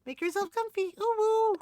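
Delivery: very high, loud sweet kitten voice; high cute villager voice. Acted. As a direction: coo it like a cartoon cat. Reads Lolly's dialogue.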